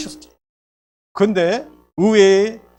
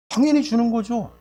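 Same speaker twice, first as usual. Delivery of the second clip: usual speaking voice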